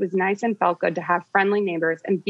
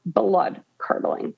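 ordinary talk, nothing marked

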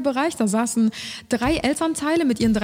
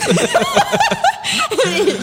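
high, burst-out laugh; real laugh; 4 dB louder than usual. Real, not acted. Laughing while telling the friend about the psycho stare. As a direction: cracks up at her own story.

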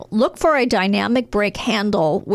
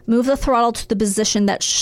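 ordinary delivery

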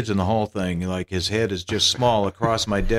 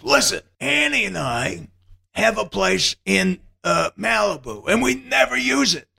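much higher, generous Mel Brooks voice; 11 semitones higher than usual. Retold or imitated. Mel Brooks offering Eugene his Malibu place, much higher. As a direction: offer it grandly. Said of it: "He said".

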